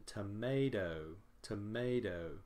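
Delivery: "tomato" with a long, prominent second syllable; stressed middle syllable. Shown as a demonstration. Teacher showing students the pronunciation, stress on the middle of "tomato". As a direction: stretch the MAY in "tomato". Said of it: "a stressed syllable in the middle".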